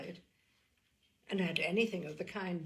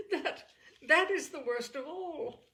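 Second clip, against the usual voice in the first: a short, rueful laugh through the words, higher; 7 semitones higher, 5 dB louder than usual. A narrator's reading. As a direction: laugh at the bitter irony of it. Elizabeth admits a painful truth.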